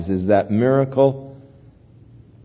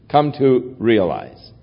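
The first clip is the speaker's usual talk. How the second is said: usual speaking voice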